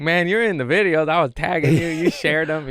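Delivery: high, loud retold objection; worked-up mock accusation; acted-out protest. Acts out silent protest to manager.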